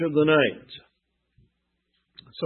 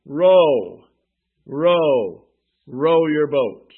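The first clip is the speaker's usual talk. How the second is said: loud chanted row, slowing down; a hammered rowing call; 6 dB louder, much slower than usual. Acted. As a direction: call each row like an oarsman's cadence. Preacher urging rowing.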